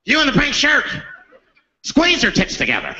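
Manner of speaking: mock hostage-taker giving orders; comic crazed demand; making demands. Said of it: "he starts making demands".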